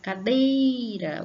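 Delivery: Cadeira called out, DEI high and long; sílaba forte. Shown as a demonstration. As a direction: pull hard on the DEI of Cadeira. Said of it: "a gente sente puxar aqui". (Cadeira com o DEI forte e alongado)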